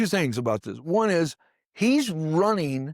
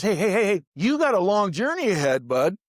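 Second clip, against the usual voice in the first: a hint of a friendly angel; 6 semitones higher than usual. Angel waking Elijah with food.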